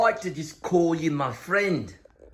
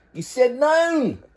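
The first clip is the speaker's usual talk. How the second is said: high slow singsong; 6 semitones higher than usual. Pretend. Repeats line in two voices.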